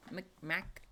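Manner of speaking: ordinary delivery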